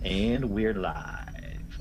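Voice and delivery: very slowly stretched show opening; slow, drawn-out live intro; drawn-out on-air announcement. Real, not acted. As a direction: draw it out as you announce the start. Game master announces recording start.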